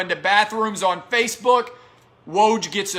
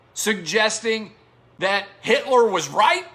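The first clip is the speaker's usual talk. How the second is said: normal talking voice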